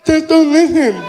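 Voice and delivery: put-on very loud, high deaf-accented punchline; emphatic deaf man's advice; imitated deaf athlete. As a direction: belt it out in deaf speech. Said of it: "he was partially deaf".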